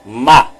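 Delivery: "ma" as one isolated syllable; "ma" set apart, teacher demonstrating; syllable read aloud. Shown as a demonstration. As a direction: read "ma" alone, low and distinct. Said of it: "aqui nós temos a segunda sílaba".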